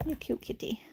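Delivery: soft, whispery pet talk; whispered cooing. Real, not acted. Adoring the cute kitty, whispered cooing. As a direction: whisper sweetly to a cat.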